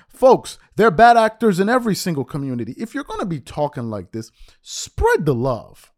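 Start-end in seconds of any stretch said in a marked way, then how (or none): 4.6-5.9 very slow, stretched words; drawn-out plea. Real, not acted. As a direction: stretch it out as an appeal. First take, drawn out.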